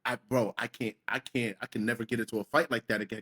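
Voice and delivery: hushed, low scared mumble to self; retold scared. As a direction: drop right down, soft and low, recounting the fear. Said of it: "I was so scared".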